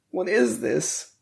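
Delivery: high, slow, plaintive question; drawn-out high character voice; bewildered character. A narrator's reading. Wounded Ruby questions what she sees, bewildered.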